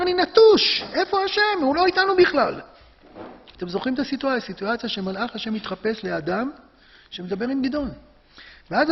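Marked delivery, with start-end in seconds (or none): 0.0-2.6 high, loud cry of abandonment, acted; frustrated outcry. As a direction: protest as someone left on his own. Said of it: "הוא מדבר בתסכול". (זעקת תסכול של נטוש)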